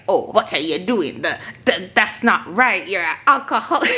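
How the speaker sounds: mock scolding Jamaican mom; hammed-up scolding mother; imitating Jamaican mother. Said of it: "my mother, she take it on herself".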